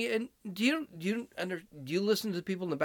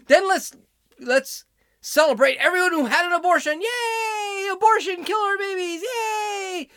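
high, loud mock celebration; hammed-up fake yay; sarcastic cheering; 11 semitones higher, 5 dB louder than usual. Mocking the clip's celebration.

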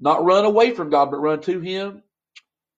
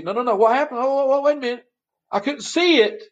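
higher, hammed-up alarm; mock panic; 10 semitones higher than usual. Pastor plays burnt beachgoer, mock alarm. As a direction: play the sunburnt beachgoer realizing.

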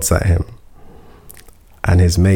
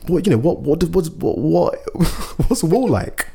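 mock stammer; 7 semitones higher, 3 dB quieter than usual. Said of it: "he said".

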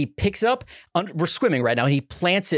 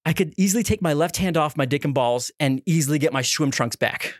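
ordinary delivery

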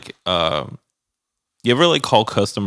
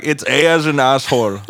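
mock agent spelling, higher and louder; customer service rep; 4 semitones higher, 7 dB louder than usual. Agent spells a caller's email, mock earnest emphasis. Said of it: "they'll be like".

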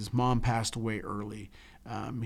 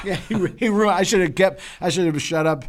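laughing; 5 semitones higher, 9 dB louder than usual. Real, not acted. Laughing after the punchline.

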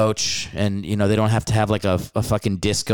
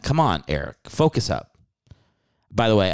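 usual speaking voice

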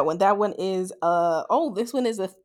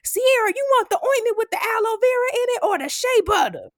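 much higher, sing-song calling voice; mom calling across aisles; 13 semitones higher than usual. Retold or imitated. Mom calling to her daughter two aisles over, high call. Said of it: "here go my mama from two aisles over going".

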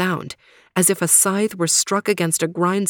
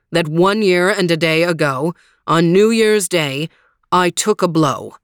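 Green Knight voice; 3 semitones lower than usual. Acted. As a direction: boom out the terms calmly.

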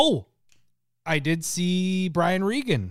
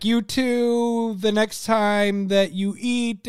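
high, loud, slow flustered comic reply; Brian Regan impression; 7 semitones higher, 5 dB louder, much slower than usual. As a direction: mimic a comedian's awkward cadence. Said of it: "his cadence".

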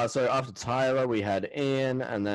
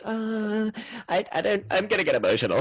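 higher, wobbly fake-tearful voice; mock emotional Keisha; 10 semitones higher than usual. Mimicking housemate Keisha's teary speech, high mock-tearful. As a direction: play a contestant about to cry, higher. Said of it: "Keisha gets up there and goes".

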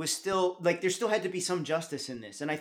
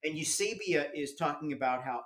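ordinary talk, nothing marked

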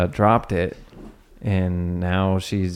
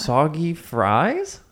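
high, slow disbelieving question; incredulous; 7 semitones higher, much slower than usual. Real, not acted. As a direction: stretch it out in disbelief. Disbelief at soggy fries.

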